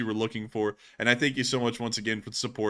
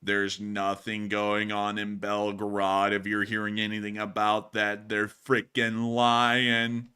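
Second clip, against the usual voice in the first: low, drawn-out skit character; slow, low denial of any trouble; Hot Dog Man; 4 semitones lower, slower than usual. Pretend. Gaslighting viewers about Belgrade, low slow skit voice. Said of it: "Hot Dog Man is excellent at gaslighting people".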